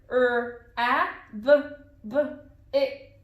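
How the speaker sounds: "Er, a, b, b, it" as separate phonics sounds; sounding out. Shown as a demonstration. Teacher reads the sounds in the word, "Er, a, b, b, it" one by one. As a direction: pause between sounds: "Er", short "a", buh, buh, "it". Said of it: "Let's read the sounds".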